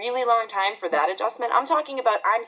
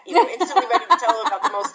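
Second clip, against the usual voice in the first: laughing; 4 semitones higher, 5 dB louder, faster than usual. Real, not acted. Laughs at own eagerness.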